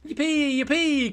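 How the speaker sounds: very high, loud cheer; jubilant cry. A narrator's reading. Terry cheers the trapped bee, jubilant cry. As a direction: celebrate in a storybook squeal. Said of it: "cried Terry D".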